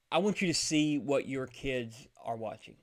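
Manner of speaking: usual speaking voice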